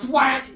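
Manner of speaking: loud high whack noise; thwack sound effect. Acted. Storyteller voicing the hit, loud thwack.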